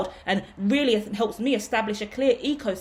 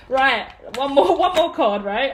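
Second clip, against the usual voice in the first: laughing; 4 semitones higher, 5 dB louder than usual. Real, not acted. Laughing while drawing card.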